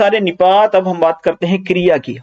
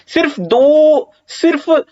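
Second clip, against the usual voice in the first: सिर्फ दो stretched slow and pitched high; emphatic सिर्फ दो; 9 semitones higher, much slower than usual. Shown as a demonstration. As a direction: lean hard on सिर्फ दो to show emphasis. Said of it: "तो बल दे रहे हो ना".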